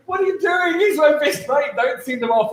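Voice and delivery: high, loud, laughing plea to the ref; mock outrage, half laughing; retold pleading shout. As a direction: beg the ref not to send your mate off. Rob begs ref on field.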